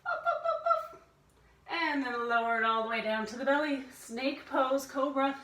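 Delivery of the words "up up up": high puppy barks; quick, high-pitched woofs; dog bark. Shown as a demonstration. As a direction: yip like a small dog. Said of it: "upward facing dog".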